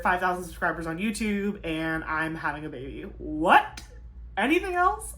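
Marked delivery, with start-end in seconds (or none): 3.2-5.1 sudden high mock surprise; surprised. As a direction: act stunned, then ask for more. Mock surprise listing milestones.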